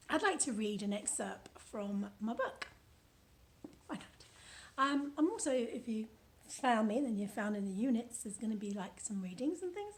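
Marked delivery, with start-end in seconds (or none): none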